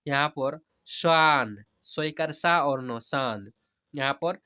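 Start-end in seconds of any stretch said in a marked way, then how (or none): 0.9-3.5 very slow teacher's demo, long stretched vowels; slow spelling-out. Shown as a demonstration. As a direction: spell each letter slowly, then draw out the whole word. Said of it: "जैसे मैं हिजे करा कर पढ़ रहा हूँ". (धीरे-धीरे हिज्जे करके, स्वर खींचकर)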